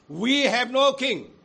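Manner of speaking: loud defiant crowd shout; shouted answer. Acted. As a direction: fling it at Pilate. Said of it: "The chief priests answered".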